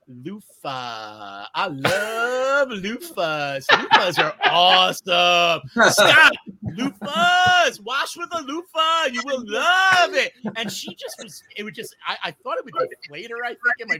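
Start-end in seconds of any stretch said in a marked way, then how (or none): 0.1-1.4 low, soft, slow drawn-out word; mock-calming. Drunk friend deflating an argument, mock-soothing. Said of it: "most calming word I could think of". 1.5-10.3 slow, stretched-out crooning building high and loud; hammed-up drunken gushing. Drunk friend derailing couple's argument, mock-rapturous.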